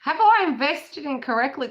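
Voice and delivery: high, loud mock-anxious whine; mock harping. Mimicking how we sound harping on, mock harping. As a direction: fret in a put-on high voice. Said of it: "that's what we sound like harping on".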